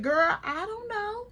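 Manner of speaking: high, slow, drawn-out dodge; evasive uncertainty. Retold or imitated. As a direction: stretch it high as if reluctant to answer. Acts out her evasive answer.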